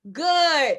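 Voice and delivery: ordinary talk, nothing marked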